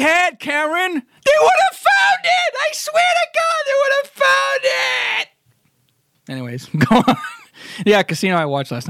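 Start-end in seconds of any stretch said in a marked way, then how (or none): 0.4-5.3 frantic shout. Acted. Acting a Goodfellas quote.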